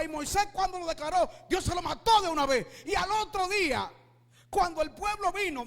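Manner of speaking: fiery sermon shouted out to the congregation; preaching at full force. Real, not acted. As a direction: thunder it from the pulpit.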